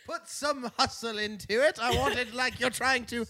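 loud, high British coach impression; mock British scolding. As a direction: shout team orders in hammed-up British. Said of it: "I scolded them in a British accent".

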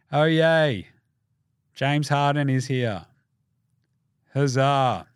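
slow, deadpan mock celebration; sarcastic cheer. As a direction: cheer with no enthusiasm at all. Acted unenthusiastic players greeting Harden.

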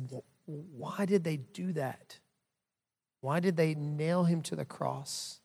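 soft, slow, halting question; hushed child's question. Retold or imitated. Dad retelling his six-year-old's question about the cross, hushed. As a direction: ask it quietly, as a hurt child would.

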